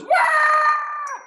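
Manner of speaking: long high, loud wail; drawn-out shriek of alarm; horrified scream. A narrator's reading. Boy horrified by monsters in closet.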